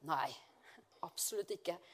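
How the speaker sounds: unvoiced, hushed denial; whispered no. Real, not acted. Preacher denying the Lord wants stay-at-homes, whispered. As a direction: drop to a whisper to deny it.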